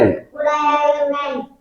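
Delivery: class chorus. Real, not acted.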